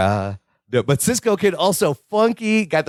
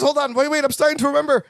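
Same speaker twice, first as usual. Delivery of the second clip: higher, excited mock Larry; imitating Larry remembering; 9 semitones higher, faster than usual. Retelling the episode, host voices Larry remembering, higher. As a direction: reach up high as memories come back. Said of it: "He goes".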